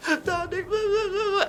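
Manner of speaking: high whiny blah blah, mildly tearful; high mock sobbing friend; mock crying friend. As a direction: imitate a crying friend, hammed up. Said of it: "my friends start crying".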